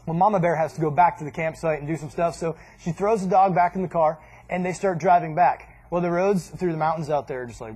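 usual speaking voice

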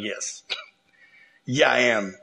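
low, soft, amused voice of God. Acted. God answers the boasting king.

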